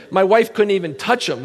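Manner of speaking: ordinary talk, nothing marked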